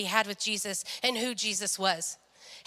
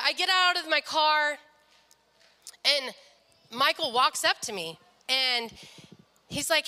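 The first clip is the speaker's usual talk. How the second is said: ordinary delivery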